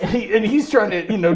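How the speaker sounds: ordinary delivery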